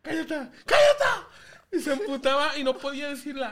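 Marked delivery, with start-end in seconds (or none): 0.0-0.3 retold boy's cry, "cállate" with A for the final E; niño que confunde vocales. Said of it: "él no puede decir la E". 0.7-1.3 high, loud mock yell of a boy; niño que confunde vocales. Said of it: "él no puede decir la E".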